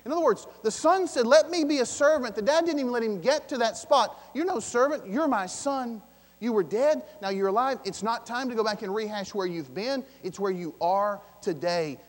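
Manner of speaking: loud, high, fervent preaching; preaching at full force. Acted. Pastor to congregation, loud fervent preaching. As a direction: proclaim it with rising fire. Preacher building to the father's welcome.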